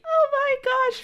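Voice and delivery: high squealy self-parody; mock squeal. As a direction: squeal like a giddy freshman. Mocking freshman self.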